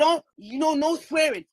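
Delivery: mock shout. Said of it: "he said".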